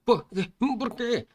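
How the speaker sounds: high-pitched cowardly protest; put-on high coward voice; whining coward. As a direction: squeak it like a reluctant coward. Cowardly boy answers the order.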